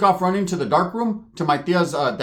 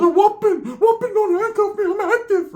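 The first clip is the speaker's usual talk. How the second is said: Ricky voiced much higher, a squeaky mock voice; high put-on voice. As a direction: squeak it out as the cousin. Cousin voiced in a story.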